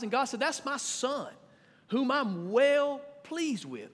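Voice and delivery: high, urgent preaching; pleading preacher pitch; preaching at full force. Acted. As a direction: press them hard from the pulpit. Preacher presses congregation on baptism.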